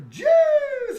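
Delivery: sudden high, loud hail; excited greeting. Real, not acted. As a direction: call the name out delighted. Greeting a chat viewer.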